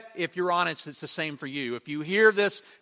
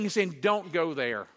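normal talking voice

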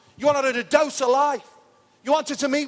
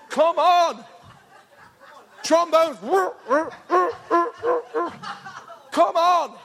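laughing rally; 4 semitones higher, slower than usual. Real, not acted. Preacher laughing, rallying the congregation for trombones. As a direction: cheer it, cracking up.

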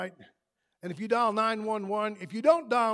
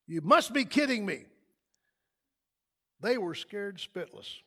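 ordinary delivery

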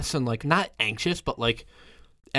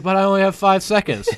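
panicked; 7 semitones higher, 6 dB louder than usual. Retold or imitated. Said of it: "you're just like".

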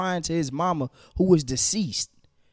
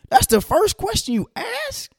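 much higher, slow, disbelieving question; can you believe this, drawn out high; telling listeners about a traffic stop, indignant; incredulous. Real, not acted. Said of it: "outraged at the cop".

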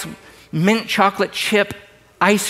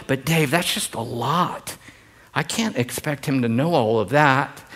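quiet whiny objector; 4 semitones lower, 5 dB quieter than usual. Retold or imitated. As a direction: mimic a church member making excuses, softly. Voicing an excusing congregant.

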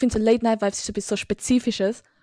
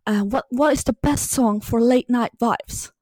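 imitating an English street interviewer; slow English accent, like a TikTok street interviewer; english accent in german; slower than usual. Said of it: "macht er es noch mit seinem englischen Akzent".

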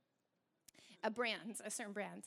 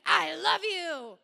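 harsh loving words; 4 semitones higher, 13 dB louder, much slower than usual. Retold or imitated. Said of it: "I don't feel very loved right now".

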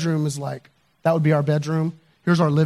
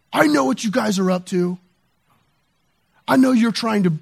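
retold accusation, pitched up; confrontational; 6 semitones higher, 4 dB louder than usual. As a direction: imitate a neighbor confronting you. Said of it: "from this confrontational".

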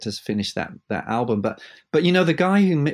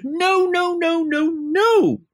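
high, loud, theatrical no-no-no; hammed-up camp maestro stopping the orchestra; camp theatrical conductor; 5 dB louder, much slower than usual. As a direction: flamboyant conductor halting the players, high and loud. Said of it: "a very theatrical voice, let's say, kind of camp".

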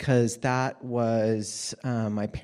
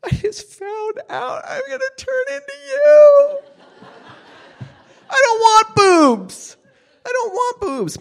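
high, much louder wailing cry; crying shout; 14 dB louder than usual. Retold or imitated. A girl crying to her mom that she doesn't want boobs, wailing. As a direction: sob and shout it out in full despair. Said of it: "I sobbed".

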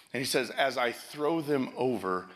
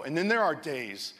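normal talking voice

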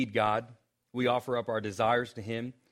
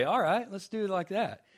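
lightly put-on higher Southern preacher voice; mock Southern Baptist voice; 8 semitones higher than usual. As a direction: toss it off like an old seminary hand, higher. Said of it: "being Southern Seminary guy".

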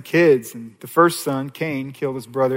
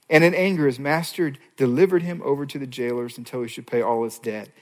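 normal talking voice

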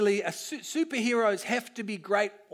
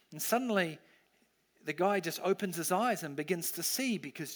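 guided imagining; 3 dB quieter than usual. Acted. Preacher leading the kids through a quiet imagining, soft and reflective. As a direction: paint the scene gently, inviting them to picture it.

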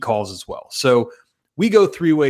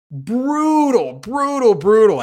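high, louder groaning lament repeated; brutal lament; 9 semitones higher, 5 dB louder, slower than usual. Real, not acted. After Dick checks back yet again, a high agonized groan. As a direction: wail it in mock agony.

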